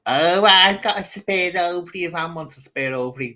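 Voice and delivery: loud, high sing-song taunt; mock jeering. Woman imitating a rival jeering at her, mock taunt. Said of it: "jeering me about".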